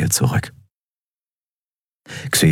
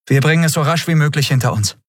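put-on higher, quick, impatient young captain; Endriel, brisk captain; 11 semitones higher, faster than usual. A narrator's reading. Captain Endriel urges the crew to move on, higher and quick. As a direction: hurry them along, half joking.

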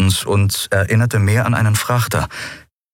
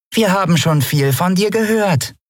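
pointed, higher Yadi voice with a knowing weight; meaningful; 12 semitones higher than usual. Acted. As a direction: say it like you know more. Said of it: "sagte die Yadi bedeutungsvoll".

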